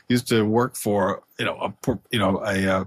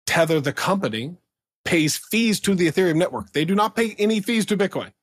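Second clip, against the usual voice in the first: rising excitement; 8 semitones higher than usual. Real, not acted. Driving home the Tether point.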